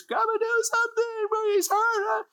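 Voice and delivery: high, pleading voice close to tears; near tears. Retold or imitated. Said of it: "she's practically in tears".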